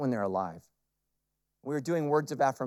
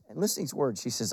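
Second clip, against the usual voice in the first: ordinary delivery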